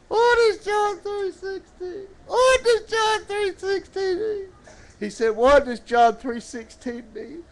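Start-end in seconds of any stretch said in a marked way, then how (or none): 0.1-4.5 high puppet mock-crying as the boy; tearful puppet. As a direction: wail the question in a high puppet voice.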